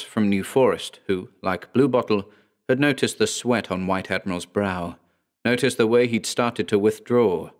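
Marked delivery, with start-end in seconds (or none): none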